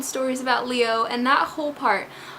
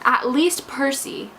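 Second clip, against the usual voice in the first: ordinary talk, nothing marked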